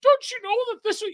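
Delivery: high, whiny mock of a self-righteous kid; mocking preachy kid. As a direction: squeak it out like a prissy know-it-all child. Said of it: "You were that one kid that said".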